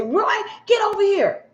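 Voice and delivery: high, sharp call at normal volume; bossy wife calling. Retold or imitated. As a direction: summon him like a controlling wife. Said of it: "You hear his wife say".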